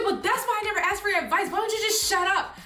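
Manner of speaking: mock angry daughter. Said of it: "she was yelling at her".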